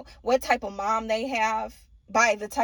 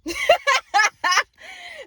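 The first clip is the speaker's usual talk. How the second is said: very high, loud cackle; laughing; 7 dB louder than usual. Real, not acted. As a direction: crack up in a high squeal of laughter. Own laugh at the reading.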